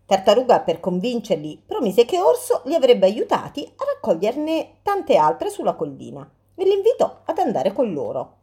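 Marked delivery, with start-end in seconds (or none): none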